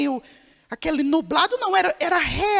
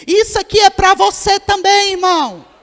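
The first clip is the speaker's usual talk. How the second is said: "Isso aqui é pra você também" called out much louder to the congregation; loud exhortation; 3 semitones higher, 9 dB louder than usual. Shown as a demonstration. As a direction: proclaim it loudly to the brother beside you. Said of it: "fala assim". ("Isso aqui é pra você também" dito bem alto, como exortação ao irmão)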